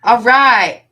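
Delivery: P=A; loud. Real, not acted. Success after message request.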